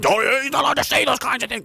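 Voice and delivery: very high, silly protesting voice; ridiculing, high-pitched imitation of objectors; vehement mock objection. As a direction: mimic an outraged scold in a squeaky pitch. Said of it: "they will vehemently oppose".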